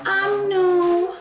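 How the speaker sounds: put-on high childish pouty no; toddler voice. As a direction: answer like a stubborn toddler. Voicing toddler's refusal.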